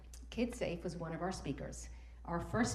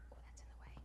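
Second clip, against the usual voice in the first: whispered aside; 18 dB quieter, much faster than usual. Real, not acted. Aside about something blocking slide.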